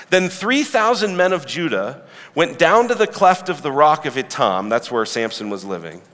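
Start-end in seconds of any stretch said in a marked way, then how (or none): none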